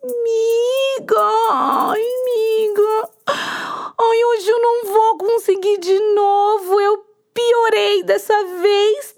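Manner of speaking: high, moaning, fake-sick cockroach; whiny sick cockroach. Cockroach moans to the hen that she is too sick. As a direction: whimper out excuses, playing sick.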